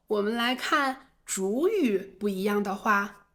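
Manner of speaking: ordinary talk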